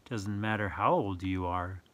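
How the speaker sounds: ordinary delivery, nothing marked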